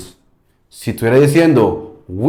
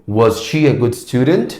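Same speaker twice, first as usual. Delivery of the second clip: "student" rising, left open as a question; open question tune. Shown as a demonstration. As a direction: let "student" rise and hang open. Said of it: "estoy dejando como abierta la entonación". (entonación abierta, "student" subiendo como pregunta)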